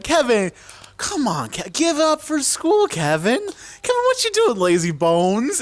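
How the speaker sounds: high, singsong mock-mom voice; hammed-up mother calling her boy; mom rousing son. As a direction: coax a sleepy kid out of bed in a put-on high voice.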